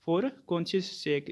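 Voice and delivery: drawn-out example, word by word; slow dictation. Shown as a demonstration. Teacher reading an example to students, very slowly. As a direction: spell the phrase out slowly for the class.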